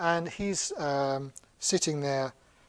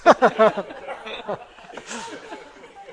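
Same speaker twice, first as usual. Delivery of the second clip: long, loud laughing fit; real high burst of laughter; hearty laugh; 7 semitones higher, 8 dB louder than usual. Real, not acted. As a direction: laugh freely at the absurd punchline. Amused at his own story.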